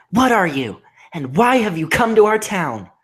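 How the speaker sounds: put-on high, loud girl voice; steady young heroine. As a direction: voice Twilight, bold and high. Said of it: "she asked".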